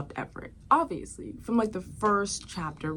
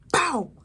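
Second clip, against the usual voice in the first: stab sound; 8 semitones higher, 4 dB louder than usual. Retold or imitated.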